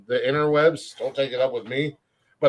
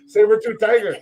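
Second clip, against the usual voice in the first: high, louder hero-style call; announcer call; 7 semitones higher, 3 dB louder than usual. Pretend. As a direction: shout it like a morphing call. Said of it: "Mama Joe's on her porch going".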